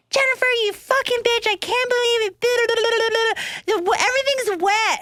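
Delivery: high pitched imitation of an angry cousin; mock angry yell. Imitating cousin cursing her from the shower, high mock yell. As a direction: squeal it up like she's furious.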